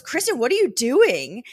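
loud, high baffled question; baffled Kristen. Retold or imitated. Michael baffled at Kristen on the bed, loud disbelieving question. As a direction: ask in disbelief what she's doing. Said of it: "Michael goes".

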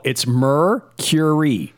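MERCURY slowly, as "MER-kyur-ee", with the y-glide; correct pronunciation. Shown as a demonstration. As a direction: draw out Mercury in three clear syllables, "mer-kyur-ee". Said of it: "having a hard time saying [name]".

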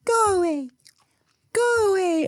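put-on high, strained little-kid voice; toddler tantrum. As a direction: imitate a tiny kid with balled fists. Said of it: "she'll ball up her little fist".

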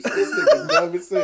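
cracking up mid-sentence; words squeezed out through laughter; laughing hard. Real, not acted. Laughing hard at friend's quote.

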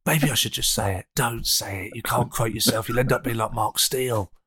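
normal talking voice

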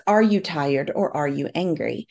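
ordinary talk, nothing marked

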